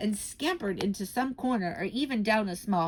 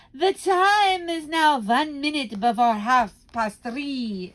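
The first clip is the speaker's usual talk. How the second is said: slow, loud, higher witch proclamation; grand announcement; 6 semitones higher, 7 dB louder, slower than usual. Acted. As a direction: announce the hour like a ringmaster. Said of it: "announced the Grand High Witch".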